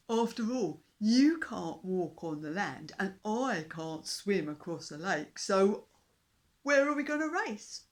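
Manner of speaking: loud, slow, mocking beast voice; scoffing dinosaur. A narrator's reading. Dinosaur scoffs at the fish king. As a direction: laugh off the fish's plan.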